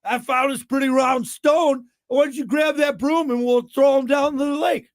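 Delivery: loud, high-pitched hammed-up drunk shouting; hammered drunk tavern-goers. Drunk curlers inventing a game after the tavern, hammered and shouting. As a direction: shout it out like a reveler fresh from the pub. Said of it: "hammered drunk after leaving the tavern".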